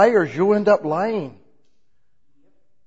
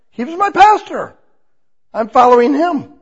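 much higher, louder stress on pastor; emphatic exclamation; 10 semitones higher, 9 dB louder than usual. Real, not acted. Preacher stressing the man was his own pastor, high and loud.

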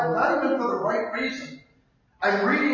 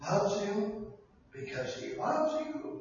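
much softer, slow, loves you; soft start; 10 dB quieter, slower than usual. Acted. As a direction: say it gently first. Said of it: "repeated refrain, quiet then shouted".

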